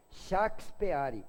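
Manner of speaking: Shakespeare said very slowly as shah-kees-peh-AH-ree; Shakespeare stretched out, Portuguese shah-kees-peh-AH-ree; Portuguese pronunciation. Shown as a demonstration. Like Rui Barbosa, Shakespeare in Portuguese, very slow. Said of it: "não falava na nossa".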